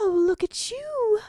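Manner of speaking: high, loud delighted mother; mother marveling at her pup, high; motherly gasp. A narrator's reading. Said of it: "a motherly voice gasps".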